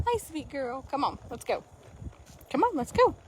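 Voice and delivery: soft sweet greeting to a horse; tender. Real, not acted. Rider softly greeting her nervous mare before moving on.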